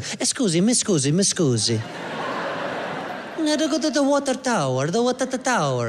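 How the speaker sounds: lightly hammed-up Italian tourist accent; a hint of a cartoon Italian accent; mock Italian accent. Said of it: "cute little Italian couple that's like".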